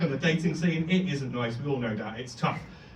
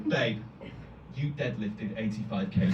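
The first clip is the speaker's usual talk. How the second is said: comforting friend; 6 semitones lower, 6 dB quieter than usual. Retold or imitated. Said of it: "she puts her hand on her shoulder and she goes".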